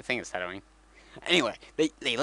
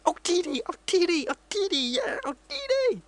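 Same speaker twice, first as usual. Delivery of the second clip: much higher, squeaky creature voice; squeaky Jawa chatter; 4 dB louder than usual. Retold or imitated. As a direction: chirp it like excited little Jawas. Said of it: "these guys come out and like".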